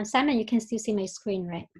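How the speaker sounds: ordinary delivery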